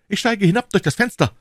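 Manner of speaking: high, loud excited cry; called out. A narrator's reading. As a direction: announce it in an urgent shout. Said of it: "rief D'Artagnan".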